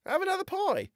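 lightly voiced friend; Dilkey voice. A narrator's reading. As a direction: brush off a lovesick friend.

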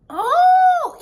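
high loud oh of discovery; light up with an idea; host realizing for the kids; realization. Acted.

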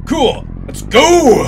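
loud, high, GO drawn out; excited call. Real, not acted. Player driving off in a new car, excited shout. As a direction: whoop it as you set off.

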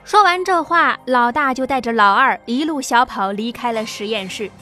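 usual speaking voice

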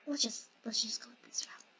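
soft unvoiced whisper. Real, not acted. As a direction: sneak it out in a whisper. Sneaking past the chaser.

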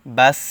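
বাস with a thin, hissed final s and light vowel; pronounced with sin. Shown as a demonstration. Teacher contrasts two letters, বাস said with a thin sin. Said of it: "বা সিন জবর".

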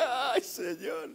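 high, drawn-out mock wail to God; hammed-up pleading dread; acted alarm. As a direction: cry out as if the boat is sinking, stretching it slowly. Acts his dread as boat sinks.

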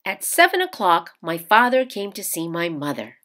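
usual speaking voice

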